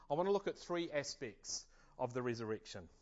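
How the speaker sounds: normal talking voice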